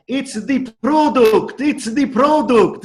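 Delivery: put-on high, loud, drawn-out Italian accent; thick Italian accent. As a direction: mimic an Italian cleaning lady's heavy accent, slow and loud. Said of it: "with her thick accent".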